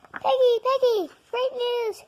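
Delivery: high excited calling. Acted. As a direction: call out the good news eagerly.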